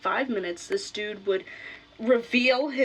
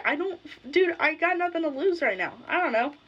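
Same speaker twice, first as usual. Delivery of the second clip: higher-pitched, carefree shrug of a line; mock reckless; 6 semitones higher than usual. Telling the meth-high intruder she has nothing to lose, high carefree.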